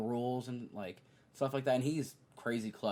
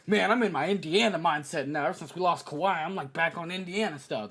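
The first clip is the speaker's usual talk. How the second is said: mock bragging; 5 semitones higher, 6 dB louder than usual. Mocking Paul George bragging.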